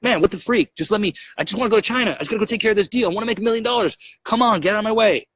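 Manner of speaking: high-pitched exasperated outburst, rushing through; frustrated rant. Retold or imitated. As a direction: whine it out like a man fed up with red tape. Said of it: "I was frustrated".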